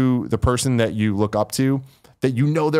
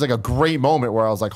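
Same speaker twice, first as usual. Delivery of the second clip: usual speaking voice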